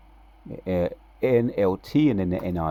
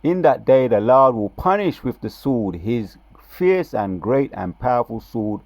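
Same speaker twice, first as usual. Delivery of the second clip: louder, higher scripture reading, slowed on the key words; emphatic preaching; 4 semitones higher, 6 dB louder than usual. Shown as a demonstration. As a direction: hammer every phrase for emphasis. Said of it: "For emphasis".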